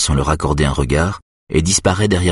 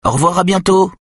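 put-on much higher, louder child's "Au revoir, à bientôt" rattled off by rote; a boy reciting the goodbye on autopilot; the boy answering automatically, high and rote; mechanical reply; 14 semitones higher, 3 dB louder than usual. A narrator's reading. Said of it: "répond Nick machinalement".

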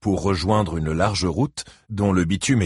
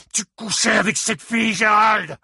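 bellowing. A narrator's reading. Father bellows at Gérald about the affair, enraged. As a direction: roar the accusation. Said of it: "continua à beugler Buddy Lee".

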